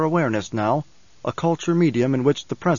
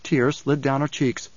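usual speaking voice

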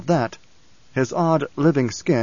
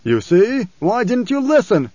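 high, urgent reproach; pleading outburst; 8 semitones higher, 4 dB louder than usual. A narrator's reading. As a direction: plead with raised pitch. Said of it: "cursing, pleading".

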